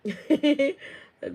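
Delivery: high, loud burst of laughter; sudden giggling laugh. Real, not acted. Reacting to a chat message from Queen, laughing. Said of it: "I am laughing".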